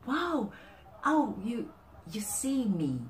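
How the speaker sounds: gasp, then high, delighted amazement; really happy. Acted. Receiver gasps at the perfect gift, really happy. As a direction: react as if deeply seen, pitch up. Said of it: "Really happy".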